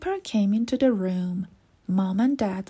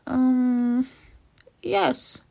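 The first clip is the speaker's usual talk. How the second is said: drawn-out um, then a high, hesitant yes; doubtful agreement; 3 semitones higher than usual. A narrator's reading. Dad asked if Gran is clever, hesitant and doubtful. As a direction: agree reluctantly after a pause.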